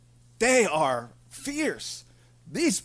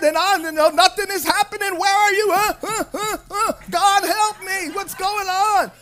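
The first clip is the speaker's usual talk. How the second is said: frantic pleader; 9 semitones higher than usual. Acted. A believer frantically begging God for answers. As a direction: whine at God like nothing works.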